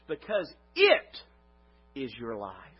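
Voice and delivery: IS slowed and drawn out; stress on IS. Shown as a demonstration. Preacher stresses "is" for the congregation. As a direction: lean on the word "is" in "Because it is your life". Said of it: "What if we were to say this".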